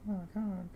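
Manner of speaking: high soft honk sound; silly clown. Pretend. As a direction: squeak a tiny high honk. Imitating the cat's honk.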